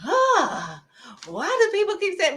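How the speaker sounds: high-pitched mock whine of frustration; exasperated complaint. Acting out someone complaining about what people say, exasperated. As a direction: huff it out high, as a put-upon complainer.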